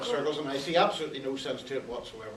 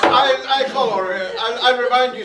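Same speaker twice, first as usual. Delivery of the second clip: calling for order; 6 semitones higher, 9 dB louder than usual. Acted. Judge calling court to order.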